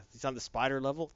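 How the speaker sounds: normal talking voice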